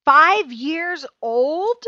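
can't believe what she just heard; shocked disbelief. Real, not acted. Host shocked by five-year rejections.

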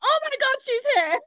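playful warning rising to a hammed-up scream; mock warning. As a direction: announce the monster, then shriek in pretend panic. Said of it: "So I give them a warning".

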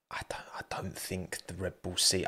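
whispered aside. Real, not acted. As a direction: let it drop to a whisper, half to yourself. Trails into aside.

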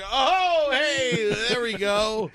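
put-on loud, high drunken greeting; drunk guy. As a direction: blurt it like a drunk stumbling in. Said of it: "He bursts in like a drunk guy".

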